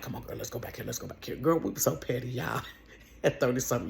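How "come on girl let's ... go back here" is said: hushed, sneaky whisper to her friend; conspiratorial whisper. Retold or imitated. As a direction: whisper it so nobody hears.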